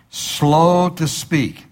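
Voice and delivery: "slow to speak" stretched out and lifted; slowed emphasis. Shown as a demonstration. Preacher to congregation, stretching slow to speak. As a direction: draw out "slow" long and high. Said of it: "preacher stresses key phrase".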